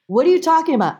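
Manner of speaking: high-pitched baffled question; incredulous daughter. Retold or imitated. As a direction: demand an explanation from mom, high. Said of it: "And I go".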